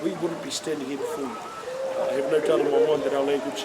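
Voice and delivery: ordinary talk, nothing marked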